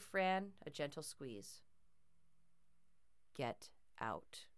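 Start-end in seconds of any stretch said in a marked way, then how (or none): 3.3-4.5 low, cold whisper; cold menacing whisper. A narrator's reading. Bear threatens girl, cold low whisper. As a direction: hiss it as a quiet threat. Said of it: "it sounded cold, almost menacing".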